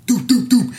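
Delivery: loud, high knocking noise; imitated door banging. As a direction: mimic three hard knocks with the voice.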